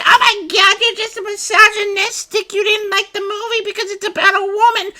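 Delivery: high, shrill mock whine held on one pitch; outraged online critic. People online scolding a movie reviewer, high mocking squeal. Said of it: "you get people being like".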